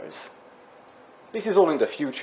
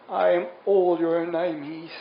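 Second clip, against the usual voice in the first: higher, put-on dying declaration; dying last words; 4 semitones higher than usual. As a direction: declare it as dramatic final words.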